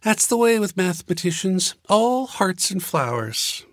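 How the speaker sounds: high, dry woman's voice mocking him; sarcastic. A narrator's reading. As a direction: needle the mathematician. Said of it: "she murmured".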